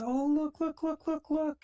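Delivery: very high, loud squeal of look; Ivy squealing look at Natalia in delight; excited squeal. Acted. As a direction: bounce on each look like a thrilled kid.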